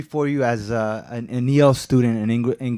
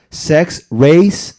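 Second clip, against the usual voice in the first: "sex" and "race" each punched hard, louder and higher; emphasis added; 4 semitones higher, 9 dB louder than usual. Real, not acted. Speaker reading a quote to the board, stressing each word hard. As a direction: hammer "sex, race" as separate stressed words. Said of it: "emphasis added".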